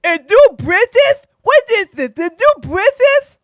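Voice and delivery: a hammed-up clueless questioner; mocking Myron. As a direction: parrot him in a dumb high voice. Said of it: "It's like".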